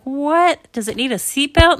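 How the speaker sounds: high, loud baffled question; bewildered parent. Acted. Parents taking baby panda home, bewildered.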